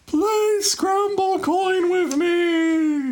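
very high, slow, drawn-out whiny plea; Anna voice. Retold or imitated. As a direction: stretch it out high and pleading. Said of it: "Fucking Anna, running across the landscape".

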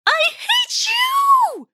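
high, drawn-out screaming cry; loudest scream. Acted. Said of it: "let out the loudest scream".

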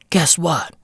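hushed teasing aside; whisper. Acted. Telling fans the cake gets shared. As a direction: whisper it like a secret.

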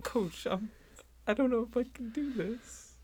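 small and scared, pleading to coach; timid player. Acted. Player answering ghost coach.

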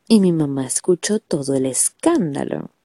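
usual speaking voice

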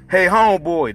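loud, high yell across the lot. Acted. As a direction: call out loud and impatient. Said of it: "Darren yelled".

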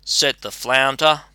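ordinary delivery

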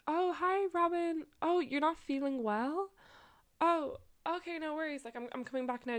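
high, chirpy greeting easing into reassurance; fake phone call. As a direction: act out answering a friend's pretend call. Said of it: "I pretend to get a call on my phone".

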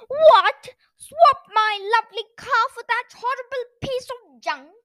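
high, loud outraged disbelief; shocked exclamation. A narrator's reading. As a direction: burst out, appalled at the very idea. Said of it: "he exclaimed".

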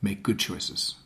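low, soft, grounded warning; deepest voice. Shown as a demonstration. Parent cautioning teenager going out drinking, low quiet authority. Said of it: "It's your deepest voice".

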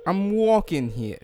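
high, very stretched New York vowels; New York accent. Shown as a demonstration. Host practising the classic line, drawn-out New York accent. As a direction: drag it out like a New Yorker. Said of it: "in a New York accent".

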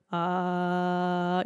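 sung low ah as a demo; low note. Shown as a demonstration. Voice coach showing a low note. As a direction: hold a low ah to show short vocal cords. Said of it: "So when we're in a low note".

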